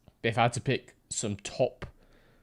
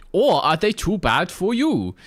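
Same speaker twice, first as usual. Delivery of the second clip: mock German accent; 8 semitones higher, 5 dB louder than usual. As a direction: read it in a hammy foreign accent. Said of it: "so I read that in the".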